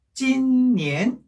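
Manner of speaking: "찐니엔" with long level "찐", then rising "니엔"; first-second tone. Shown as a demonstration. Teacher joining the syllables, "찐니엔" level then rising. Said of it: "이렇게 되겠죠".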